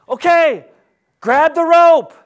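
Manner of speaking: high, loud rescuer's call. Acted. Rescuer shouts to someone in the pit, calling out loudly. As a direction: call it down to someone stuck below.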